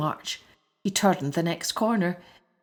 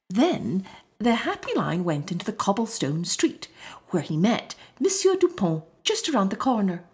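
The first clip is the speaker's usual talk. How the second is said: ordinary delivery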